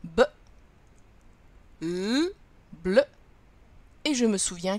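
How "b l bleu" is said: B, then held L, then short blended "bleu"; sounding out in order. Shown as a demonstration. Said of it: "je lis la syllabe dans l'ordre".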